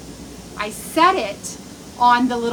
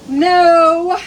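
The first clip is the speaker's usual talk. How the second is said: emphatic no; 3 semitones higher, 8 dB louder than usual. Real, not acted. Emphatic no after complaint.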